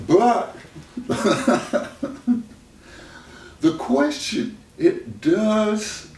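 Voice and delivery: talking high and bright through laughter; laughing. Real, not acted. Explaining to the host through laughter, still high.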